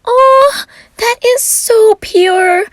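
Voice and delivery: very high, loud, slow swooning gush; gushing delight. Acted. Friend gushing over the date, high and swooning. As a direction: melt over how sweet it is.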